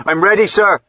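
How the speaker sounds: high, loud call from the box; a coachman shouting up, eager to obey; eager cry. A narrator's reading. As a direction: call out ready and keen. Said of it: "The coachman touched his hat and cried".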